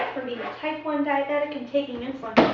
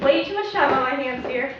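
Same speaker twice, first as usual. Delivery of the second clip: ordinary delivery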